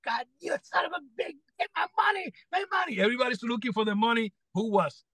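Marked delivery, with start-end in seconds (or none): 0.1-3.0 very high, quick mock tirade; retold cursing tirade. [name] cursing big time over lost money, mock tirade. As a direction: imitate frantic cursing in a high squawk. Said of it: "He started cursing big time".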